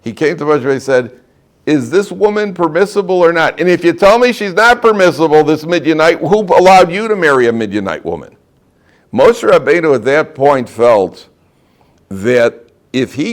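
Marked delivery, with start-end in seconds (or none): 1.7-8.3 higher, pressing cross-examination; confrontational challenge. Acted. Zimri confronts Moshe over the woman, challenging. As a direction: throw it down as an indignant challenge.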